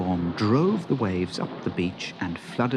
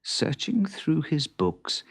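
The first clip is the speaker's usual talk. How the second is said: ordinary delivery, nothing marked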